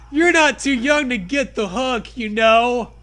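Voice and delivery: loud, lightly voiced stern mother; mock stern mom. Mom threatens the child with the hook. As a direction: warn the kid half-jokingly.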